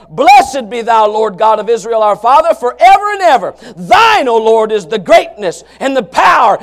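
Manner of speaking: fervent scripture reading. Acted. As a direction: proclaim the verse to the congregation. Reads scripture preaching.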